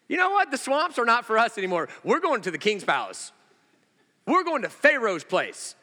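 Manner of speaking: lightly voiced indignant frogs; fed-up frogs. Preacher voicing frogs leaving the swamp, mock indignation.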